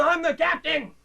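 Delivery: ordinary talk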